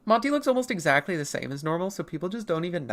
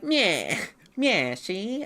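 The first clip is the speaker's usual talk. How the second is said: lightly voiced mock gangster; a hint of a tough-guy cartoon crook; little gangster; 5 semitones higher, much slower than usual. Streamer mimics English Meowth as a mild gangster. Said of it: "he sounds like a little gangster".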